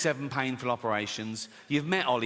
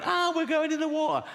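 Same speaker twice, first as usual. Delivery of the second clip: high mock panicked cry; hammed-up high panic of the passengers; 13 semitones higher, 3 dB louder than usual. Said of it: "people are running around going".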